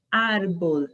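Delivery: "árbol" with an open a; Spanish árbol. Shown as a demonstration. Teacher showing open a to Portuguese speakers. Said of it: "Observem esse exemplo aqui".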